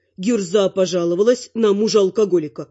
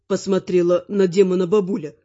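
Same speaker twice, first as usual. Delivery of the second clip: plain reading voice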